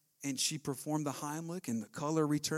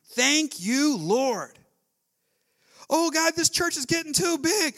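louder, higher, driving sermon delivery; full-force preaching; 7 semitones higher, 7 dB louder than usual. Acted. Pastor urging church to give thanks, full-force preaching. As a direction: preach it hard to the congregation.

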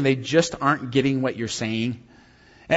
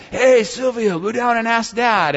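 higher-pitched imitation of a kid giving orders; scheming kids' voices; 5 semitones higher than usual. Dad imitating his kids sending Silvio to ask, conspiring. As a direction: mimic a child putting a little one up to it.

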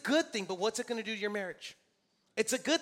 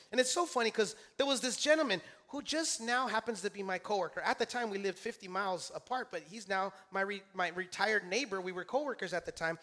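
usual speaking voice

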